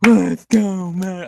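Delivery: loud, high-pitched mock fan chant; mocking imitation of chanting fans; imitated crowd yell. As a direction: yell it out like an obnoxious section of fans. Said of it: "everyone in my section just yell".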